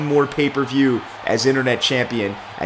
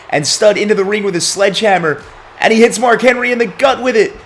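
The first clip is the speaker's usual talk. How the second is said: higher, rising ringside commentary; excited play-by-play; 5 semitones higher, 3 dB louder than usual. Acted. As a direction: sell the sledgehammer shot to the audience. Wrestling match play-by-play call.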